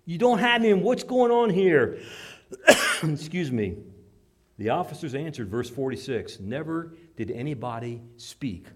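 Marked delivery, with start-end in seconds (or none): 0.0-2.0 loud, high, disbelieving questions; stunned the guards came back empty; shocked priests. Acted. Chief priests confronting returning guards, loud and disbelieving. 6.4-8.7 awed officers. Acted.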